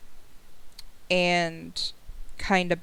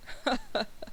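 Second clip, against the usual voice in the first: soft acted chuckle; 5 semitones higher, 8 dB quieter than usual. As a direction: chuckle lightly in character as an amused husband. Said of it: "Adello just kinda chuckles".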